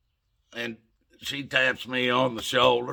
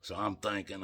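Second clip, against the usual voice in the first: plain reading voice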